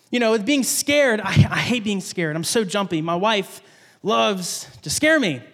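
ordinary delivery

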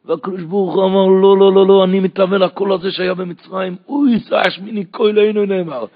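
loud, high-pitched impassioned sermon; preaching at full force. Acted. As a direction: drive the verses home at the top of your voice. (דרשה נלהבת בקול רם)

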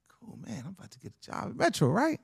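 hushed, quick, thrilled whisper to himself; a giddy whisper, barely holding in the excitement; excited whisper. Retold or imitated. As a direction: whisper it fast like a secret win. Excited private hope about job.

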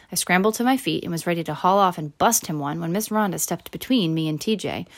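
ordinary delivery, nothing marked

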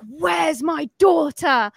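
imitating a mother crying out; demanding cry. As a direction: stretch it out like a retold desperate demand. Woman demands her missing daughter.

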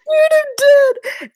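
very high, loud laughing squeal; scandalised. Real, not acted. Girl scandalised by friend's crude joke, squealing. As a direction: shriek in giddy disbelief.

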